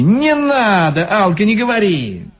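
whiny, long-vowelled objection; protesting. A narrator's reading. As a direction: stretch the plea out, begging her off. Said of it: "запротестовали все остальные".

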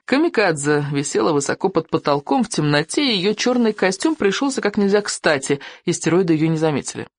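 ordinary delivery

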